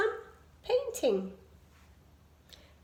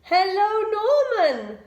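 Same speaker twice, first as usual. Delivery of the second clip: high, loud squeaky bee voices; bright high greeting from tiny bees; little bees; 8 semitones higher, 9 dB louder, much slower than usual. A narrator's reading. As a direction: chirp it up like tiny excited bees. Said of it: "said the little bees".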